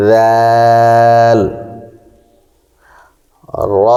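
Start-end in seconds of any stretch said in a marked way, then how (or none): 0.1-1.4 জিম recited slowly and drawn out; letter demonstration. Shown as a demonstration. Teacher sounds the letter for students, slow and drawn out. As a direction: hold জিম long like Quran recitation. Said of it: "দাঁতের আগার সঙ্গে লাগাইয়া".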